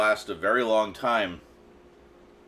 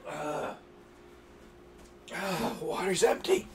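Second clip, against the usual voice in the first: dismayed whisper; 9 semitones higher, 4 dB quieter, slower than usual. Real, not acted. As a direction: whisper it, out of breath. Burning mouth, no water left.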